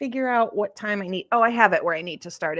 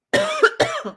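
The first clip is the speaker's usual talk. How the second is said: sudden sharp coughing; coughing fit. Real, not acted. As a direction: cough hard as if choking on air. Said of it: "Sorry, I'm choking on air".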